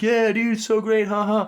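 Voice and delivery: high, overbright mock cheer; fake cheering. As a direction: pretend to be thrilled on a call.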